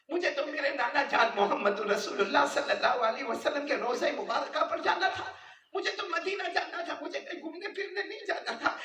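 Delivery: put-on fervent sermon voice, speaking as the boy; impassioned preaching. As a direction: plead it out to the crowd, voice climbing.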